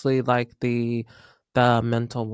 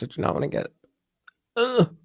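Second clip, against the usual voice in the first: breathy near tears, then a high ugh; thanking God and getting choked up; choking up; 9 semitones higher, faster than usual. Real, not acted. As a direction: fight back tears. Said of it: "I'm not getting emotional".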